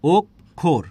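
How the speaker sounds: অক্ষর in two separate beats, "ok", "khor"; loud, separated "ok... khor" for অক্ষর; split into syllables. Shown as a demonstration. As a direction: push out each syllable of অক্ষর firmly, with a gap. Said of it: "আর একটা কী বলেছি".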